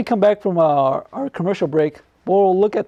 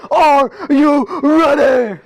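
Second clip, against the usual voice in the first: slow, stretched, higher wrestling-style hype call; hype announcer call; 7 semitones higher, 4 dB louder, much slower than usual. Acted. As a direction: drag it out like a ring announcer. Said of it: "I said".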